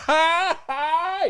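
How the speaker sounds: very high, forced "ha ha"; piercingly high fake laughter; mock laugh. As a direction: imitate an obnoxious show-off laughing. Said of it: "he's fucking laughing".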